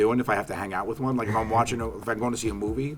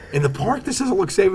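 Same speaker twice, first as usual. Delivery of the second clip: higher, louder, panicky whine; mock scared Sal; 12 semitones higher, 7 dB louder than usual. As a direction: play Sal freaking out at the bungee. Sal scared of fake bungee.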